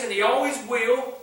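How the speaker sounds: ordinary talk, nothing marked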